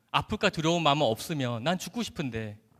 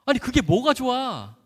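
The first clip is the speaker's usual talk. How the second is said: louder, higher incredulous retort; retold disbelief; 5 semitones higher, 5 dB louder than usual. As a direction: protest as if it's absurd, drawing out the question. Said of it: "제가 너무 황당했어요".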